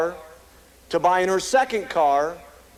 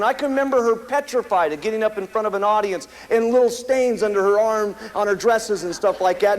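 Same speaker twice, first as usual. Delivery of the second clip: ordinary talk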